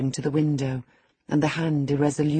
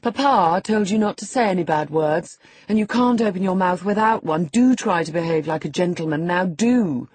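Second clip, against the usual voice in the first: higher, louder mock-prim scolding; prim scolding girl; 4 semitones higher, 5 dB louder than usual. A narrator's reading. Catherine to Hareton, higher prim scolding. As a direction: scold like a little governess.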